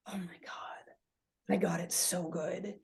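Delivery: breathy awed whisper of gratitude; hushed grateful whisper. Retold or imitated. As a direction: whisper it like you can't believe your luck.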